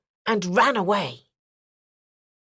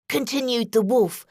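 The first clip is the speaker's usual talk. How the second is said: plain reading voice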